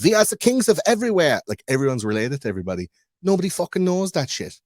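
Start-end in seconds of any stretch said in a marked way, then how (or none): 0.0-1.4 much higher, louder grand royal proclamation; mock pompous royals. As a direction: lord it over everyone like a puffed-up king. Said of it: "And they're all like".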